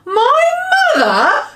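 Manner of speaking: a high, loud, astonished outburst; shocked at the very idea, cry it out; surprised cry. Acted. The giant, amazed by Sophie's question about mothers, cries out high and loud. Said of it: "cried the B F G".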